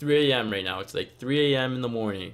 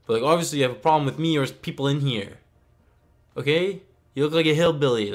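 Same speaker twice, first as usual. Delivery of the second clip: rushed, louder and higher comeback; heated retort; 4 semitones higher, 3 dB louder than usual. Retold or imitated. Telling off the aggressive stepbrother, a fast heated retort. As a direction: fire it back fast.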